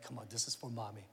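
quiet, fast coaxing murmur; dad nudging weary kids along, soft and quick. Retold or imitated. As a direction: urge them on under your breath. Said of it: "encouraging the kids".

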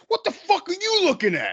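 very high, shrill-pitched mock outburst; mock angry yell. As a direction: snap at the onlookers in a cartoon voice. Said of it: "He's like".

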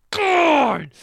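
loud, high cry of outrage; exasperated outburst. Retold or imitated. Fan yelling at the show he watches, exasperated. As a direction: burst out in exasperation.

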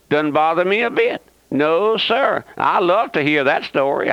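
ordinary delivery

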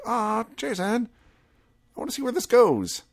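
high, whiny letdown; mock disappointed viewer. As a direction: sigh at Jason's bad timing. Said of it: "you're like".

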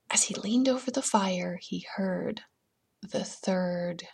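soft, near-whispered narration; slow down and hush before the reveal; spooky, barely voiced build-up; hushed suspense. A narrator's reading.

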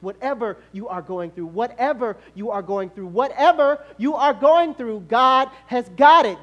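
firm start swelling to loud, high, slow shout; building preaching. Real, not acted. Preacher assuring congregation through any trial, rising fervour. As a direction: build each repeat to a climax.

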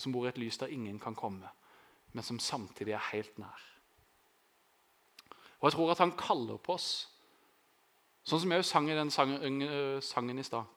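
ordinary talk

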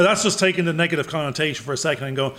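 ordinary delivery

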